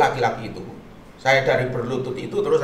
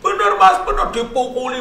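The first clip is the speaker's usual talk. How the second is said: high, loud mock outburst; high-pitched angry retelling; angry wife; 5 dB louder than usual. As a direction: imitate a wife lashing out in anger. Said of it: "Langsung istrinya langsung marah-marah".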